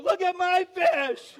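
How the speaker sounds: very high, loud, drawn-out whoop; mock boastful shout. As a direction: crow it out slowly to every passing boat. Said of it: "she's so quiet".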